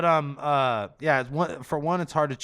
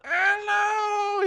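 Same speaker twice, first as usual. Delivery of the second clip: squealing stomach voice. Pretend. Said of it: "And like".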